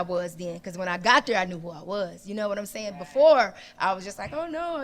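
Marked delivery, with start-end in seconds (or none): 4.3-4.8 dread. Retold or imitated. Her dread retold.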